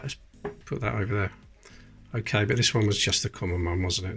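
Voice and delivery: normal talking voice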